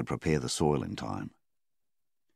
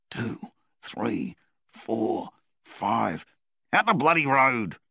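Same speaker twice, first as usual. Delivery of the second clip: higher count building to a loud shout; counting compressions; 7 semitones higher, 3 dB louder, slower than usual. A narrator's reading. Donnie counting CPR, building to a loud high outburst. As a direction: count it out then explode.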